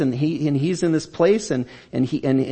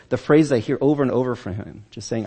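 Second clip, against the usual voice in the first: ordinary talk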